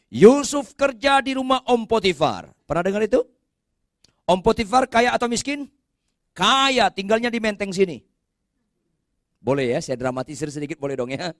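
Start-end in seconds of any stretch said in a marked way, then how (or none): 0.1-8.0 high, loud sermon storytelling; full-force preaching. Real, not acted. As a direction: call the questions out to the congregation.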